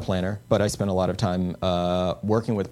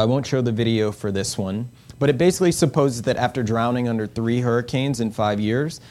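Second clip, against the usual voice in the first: ordinary talk, nothing marked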